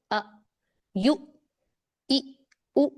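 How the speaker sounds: अ, यू, ई, ऊ each cut off with a jerk; short, jolted अ, यू, ई, ऊ; jerked hamza. Shown as a demonstration. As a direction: stop each sound abruptly at the hamza: अ, यू, ई, ऊ. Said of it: "इस तरह से झटका देना है".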